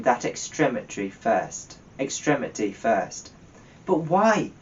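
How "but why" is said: crying out. A narrator's reading. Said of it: "cried Scrooge's nephew".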